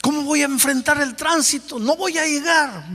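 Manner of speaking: high, rushed worried voice; fretting about the traffic; anxious hurry. Retold or imitated. Pastor retells his rush, anxious. (pastor preocupado por llegar tarde, voz alta y ansiosa)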